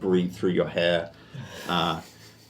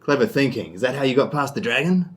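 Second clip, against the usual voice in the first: loud, higher, rough dad throwing it back; gruff dad voice; 6 semitones higher, 5 dB louder than usual. Acted. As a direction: fire the question back as the dad. DM voicing the dad.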